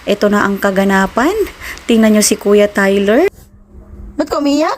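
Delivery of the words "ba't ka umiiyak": high coaxing mother voice. Real, not acted. Mother asks crying son.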